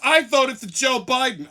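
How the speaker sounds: mocking shouted parody of her; loud sneering echo of her boast. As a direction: yell her boast back in mockery. Mocking the woman's proud claim.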